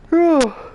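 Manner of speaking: high, forceful exhaled sigh; loud sigh. Real, not acted. Pausing to gather thoughts, loud sigh. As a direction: blow out a big breath.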